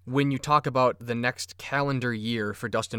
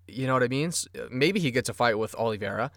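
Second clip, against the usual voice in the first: ordinary talk, nothing marked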